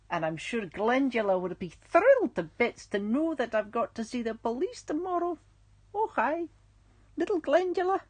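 put-on playful, lilting Scottish accent. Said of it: "I haven't done my Scottish accent".

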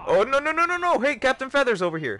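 high, loud frantic panic; panicked. Real, not acted. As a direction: yelp in a real panic. Panicking in the game.

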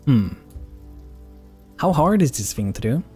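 ordinary delivery

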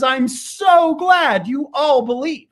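high, slow teasing challenge; spring the trap on the crowd; high, slow showman's dare. Retold or imitated.

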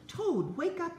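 high calling voice to wake a friend. Acted. Frog calling to sleepy Toad, high and eager. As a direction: call up high to wake him. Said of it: "he cried".

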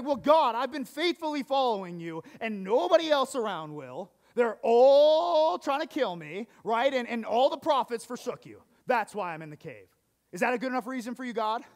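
loud, indignant self-pity; whiny complaint. Retold or imitated. Elijah protesting to God in the cave, loud and whiny. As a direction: complain at God like a sulking prophet. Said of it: "Elijah was totally in his flesh".

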